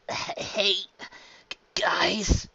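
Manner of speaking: faint unvoiced whisper; weak and sick. Sick Luffy greeting his crew, feeble whispery voice. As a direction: barely get it out, in pain. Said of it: "looking ghastly pale and holding his right side".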